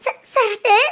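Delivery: tearful, broken call of a name; crying. Acted. Sindhu calls out to Sarathu as everyone weeps, crying. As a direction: sob the name out, stammering. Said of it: "எதுக்கு எல்லாரும் அழுகுறீங்க?".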